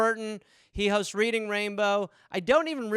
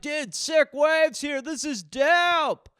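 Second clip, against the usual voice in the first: slow, high stoner surfer drawl; lazy beach bum voice, hammed up; surfer dude; 7 semitones higher, 3 dB louder, slower than usual. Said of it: "just some random surfer who's like".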